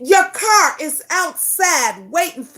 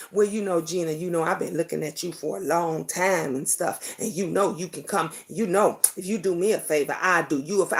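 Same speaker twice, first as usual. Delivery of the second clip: a much lower, hushed, coaxing man's voice; mock sleazy man; 10 semitones lower, 8 dB quieter, faster than usual. Retelling how her friend's man hit on her, low and hushed. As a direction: drop low and quiet for a creepy come-on.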